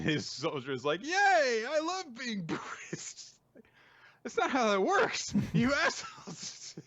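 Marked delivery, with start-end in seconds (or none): 1.0-3.3 much higher, hammed-up glee, slowing into a laugh; mock cheer. Mocking cheerful soldier. 4.2-6.7 much higher, exasperated protest through laughter; laughing disbelief. Real, not acted. Host reacting in disbelief.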